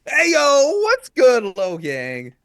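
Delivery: big, loud, high hype-man hello; hyped greeting. Acted. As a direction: fire up the crowd like a stream opener. Podcast host greets the listeners.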